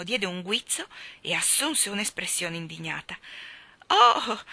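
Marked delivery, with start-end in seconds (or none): 3.9-4.4 hasty protest. A narrator's reading. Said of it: "s'affrettò a dire Nelio".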